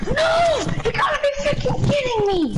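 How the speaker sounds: furious shout. Real, not acted. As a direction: scream in disbelief at the game. Furious gamer outburst.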